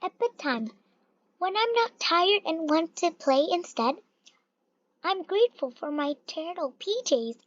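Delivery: usual speaking voice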